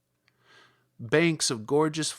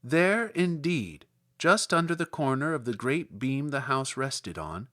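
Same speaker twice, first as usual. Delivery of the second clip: usual speaking voice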